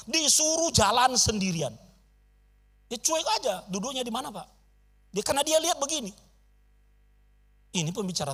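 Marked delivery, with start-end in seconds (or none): none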